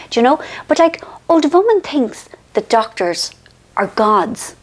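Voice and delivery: usual speaking voice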